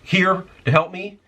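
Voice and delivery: impatient customer. Retold or imitated. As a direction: play the rude shopper who wants service now. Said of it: "he's like".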